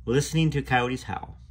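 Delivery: plain reading voice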